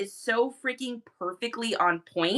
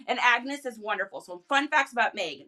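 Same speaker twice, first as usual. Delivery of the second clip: ordinary talk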